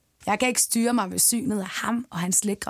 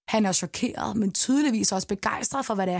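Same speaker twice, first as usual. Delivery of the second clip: plain reading voice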